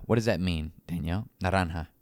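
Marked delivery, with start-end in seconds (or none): 1.4-1.9 "Naranja" said nah-RAHN-hah; Spanish "Naranja", j breathed as an h; Spanish pronunciation. Shown as a demonstration. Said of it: "you know what they call it?".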